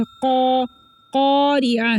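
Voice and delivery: क़ारिअह with thick q and rounded "qaw"; heavy qaf. Shown as a demonstration. Teacher pointing out the thick letter, full "qawri'ah". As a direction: fill the mouth on क़ा. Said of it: "कॉफ मोटा है".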